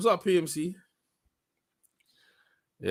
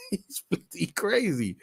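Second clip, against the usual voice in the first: laughing; 6 semitones higher, 4 dB quieter than usual. Real, not acted. Repeat with laugh.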